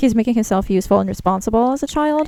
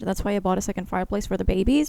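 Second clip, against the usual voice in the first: ordinary talk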